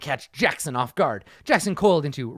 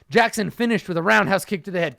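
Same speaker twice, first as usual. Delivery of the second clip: ordinary delivery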